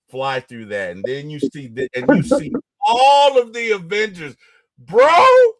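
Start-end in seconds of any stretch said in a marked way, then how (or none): 4.8-5.5 high, loud, slow hyped shout; excited shout. Real, not acted. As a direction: yell it like the best moment ever. Hyped retelling Endgame scene.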